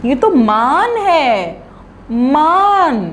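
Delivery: "मान", tongue touching the palate for the n; mān with full n. Shown as a demonstration. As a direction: say "मान" with a clear n at the end. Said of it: "इसमें तो नून का नुक्ता लगा हुआ है".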